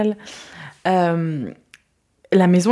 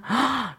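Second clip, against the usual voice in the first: excited gasp; 6 semitones higher, 4 dB quieter than usual. Real, not acted. Thrilled at his approval.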